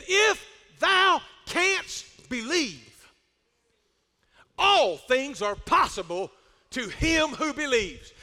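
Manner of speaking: very slow, ringing declamation; declaimed scripture. Acted. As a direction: proclaim it word by word with long pauses. Acts bishop solemnly declaiming scripture.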